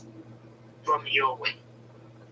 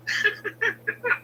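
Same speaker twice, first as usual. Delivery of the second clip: a quick high laugh at his own line; short high laugh; 4 dB quieter than usual. Real, not acted. Laughing at how he answered her.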